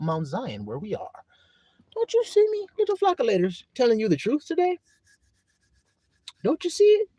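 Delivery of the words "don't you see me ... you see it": high, squeaky teasing of the flock; mock chipmunk voice asking the little flock. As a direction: tease them in a tiny high voice. Playful teasing of the congregation.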